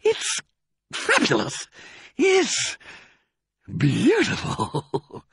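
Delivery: halting, high, overcome gasps; fighting for breath. A narrator's reading. Fox overcome by cider, breathless. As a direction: squeeze out each word between pauses. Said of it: "fighting for breath".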